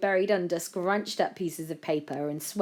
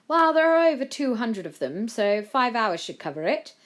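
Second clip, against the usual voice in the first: raised, higher calling voice; voice from down the corridor; 7 semitones higher, 7 dB louder than usual. A narrator's reading. A voice answers from down the corridor, calling out. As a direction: call it along the hallway. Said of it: "came a voice from down the corridor".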